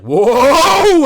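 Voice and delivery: long, loud high yell; mock Santa. Santa fleeing the crocodile, loud mock yell. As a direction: holler it in hammed-up panic. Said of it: "And then Santa's like".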